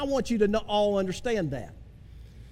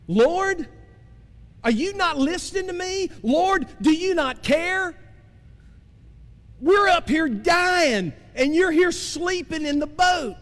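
higher, slow, pleading cry to a sleeping Lord; anguished prayer shout, pitched high; desperate plea; 7 semitones higher than usual. Acted. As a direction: beg Jesus to wake up, raised and drawn out. Voicing desperate plea to Jesus.

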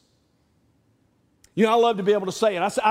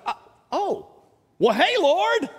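higher, sudden surprised hello; startled greeting; 10 semitones higher than usual. Pretend. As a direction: caught off guard, greet the Lord. Sudden meeting with God.

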